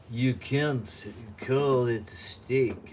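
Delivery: slow, drawn-out mock French accent; terrible French accent. News reader mocking France's steak ban in a bad French accent. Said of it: "That's my terrible French accent".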